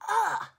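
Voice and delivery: high, loud anguished ugh; loud frustrated groan. Real, not acted. Babysitter groaning over losing the job, loud anguished ugh. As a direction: let out a pained, crying groan.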